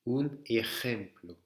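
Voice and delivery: EJEMPLO with the J scraped in the throat; J espagnol guttural. Shown as a demonstration. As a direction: model ejemplo carefully, rasped J. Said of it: "ça se dit". (J raclé dans ejemplo)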